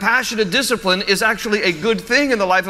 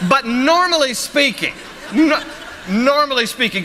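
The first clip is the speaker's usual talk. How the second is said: giggling through the words; laughing; 7 semitones higher, slower than usual. Real, not acted. Preacher laughing at his own Walmart story, high and giggly. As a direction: laugh while trying to go on.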